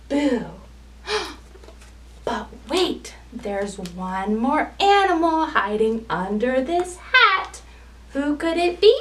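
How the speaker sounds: put-on lively sing-song storytelling for small children; picture-book read-aloud. A narrator's reading.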